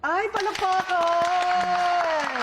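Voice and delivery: high, loud cheer calling for applause; excited cheer. Real, not acted. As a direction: call for applause, delighted and drawn out. Host cheers the guest's accent.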